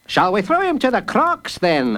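high, sneering jailer; mock the boy; sneering guard. A narrator's reading. Guard taunting a sick boy, sneering. Master Fish voice.

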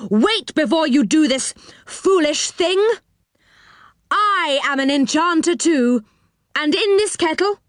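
high-pitched, grand bluff; fearless challenge. A narrator's reading. Moonface confronts the enchanter with his kettle, fearlessly. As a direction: declare it boldly to a rival. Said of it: "He went towards the enchanter fearlessly".

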